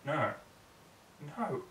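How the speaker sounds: plain reading voice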